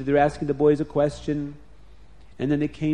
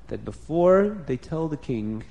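ordinary delivery, nothing marked

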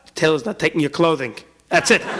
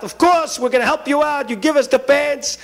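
eager reassurance; 8 semitones higher than usual. Acted. Tailor telling the ripped-pants customer they will help, high and eager. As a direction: promise to help right away.

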